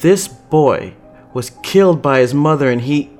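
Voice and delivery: heated, exasperated telling-off; angry scolding. Acted. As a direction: dress down a reckless junior. Chase scolds Angelica angrily.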